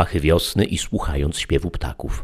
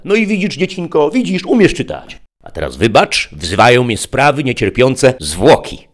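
high, loud, coaxing tempter; sly interpreter; 9 semitones higher, 7 dB louder than usual. A narrator's reading. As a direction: sweet-talk the child into it. Interpreter triumphs, leaves. (wysoki, przymilny głos kusiciela)

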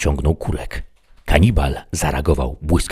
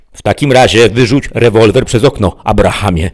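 loud, raised command; shouted order; 5 semitones higher, 8 dB louder than usual. A narrator's reading. Sheriff orders the bandit to disarm, calling loudly. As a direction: call the order through a window.

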